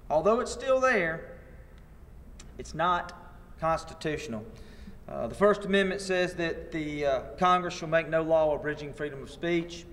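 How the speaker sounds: usual speaking voice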